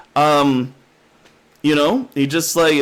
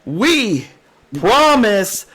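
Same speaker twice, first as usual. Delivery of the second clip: mock dramatic; 4 semitones higher, much slower than usual. Jokey sign-off.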